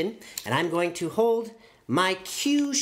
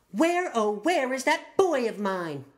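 calling mom; 7 semitones higher than usual. Acted. Mom calling for her son.

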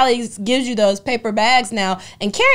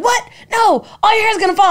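high, loud worried mother; mom's fretful outburst; alarmed mom; 11 semitones higher, 6 dB louder than usual. Retold or imitated. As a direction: panic like a mom about her daughter's hair. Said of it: "My mom's like".

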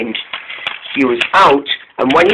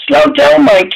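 higher-pitched warning call; urgent warning; 10 semitones higher than usual. A narrator's reading. James warns Mike coming round the bend, urgent warning. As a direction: call out a mild alarm in storytelling.

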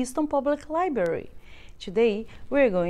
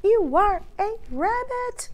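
exasperated insistence; 9 semitones higher than usual. Acted.

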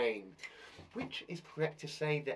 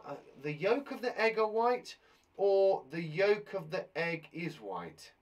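normal talking voice

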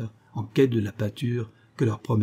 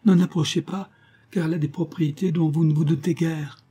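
high-pitched alarmed plea; terrified warning; 7 semitones higher, 3 dB louder than usual. A narrator's reading. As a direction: warn him off in fright. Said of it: "s'écria-t-elle avec terreur".

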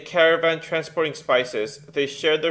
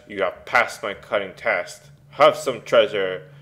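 devil voice; 6 semitones lower than usual. Acted. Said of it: "The devil cries".

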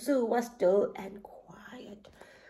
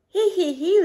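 high, louder tittering giggle; giraffe giggle; 8 semitones higher, 6 dB louder than usual. A narrator's reading. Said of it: "laughed Giraffe".

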